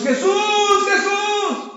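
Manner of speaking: high, drawn-out cry of a name; shouted call. Acted. Believers keep crying out to Jesús, shouting. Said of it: "podemos estarle gritando a Jesús".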